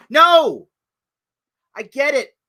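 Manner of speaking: normal talking voice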